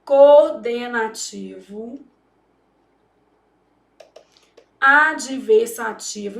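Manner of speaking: each syllable drawn out slowly. Shown as a demonstration. Teacher spelling out the grammar term slowly. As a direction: stretch the term out for students. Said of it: "também é um conector".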